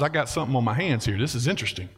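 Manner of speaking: the king murmurs a plan to himself; scheming King Saul. Retold or imitated. Saul musing over David.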